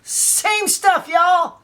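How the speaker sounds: high, exasperated cry of disbelief; exasperated exclaim. Real, not acted. Collector showing viewers identical bonus discs, exasperated. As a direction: throw up your voice in disbelief. Mock outrage at identical features.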